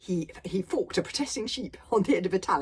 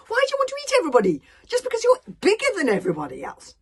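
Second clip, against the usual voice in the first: high, loud, fast protest; a small boy crying unfairness; indignant boy; 12 dB louder, faster than usual. Acted. Hiccup protesting to the dragon, fast and high.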